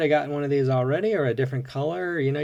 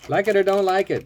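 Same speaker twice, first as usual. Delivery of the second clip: louder and higher, punching out the rule; emphatic declaration; 7 semitones higher, 6 dB louder than usual. Real, not acted. Collector declares his toy-car rule, loud and emphatic. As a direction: announce it firmly, like a motto.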